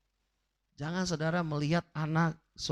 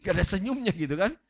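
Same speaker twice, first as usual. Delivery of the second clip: laughing; faster than usual. Real, not acted. Laughs at the sour face in the mirror.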